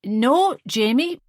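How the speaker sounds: sharp, high "no"; loud defiance. A narrator's reading. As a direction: overrule him loudly. Mother defiant.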